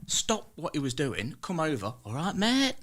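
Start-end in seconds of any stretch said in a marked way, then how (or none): 2.1-2.8 higher, friendly Cornish barber; [name]'s voice. Retold or imitated. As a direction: greet a scruffy stranger warmly, higher-pitched. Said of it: "that's an impression of me".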